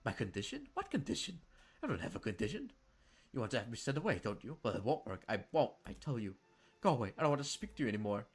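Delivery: angry sister. Acted. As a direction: push him away in a rage. Jennifer angry and defensive.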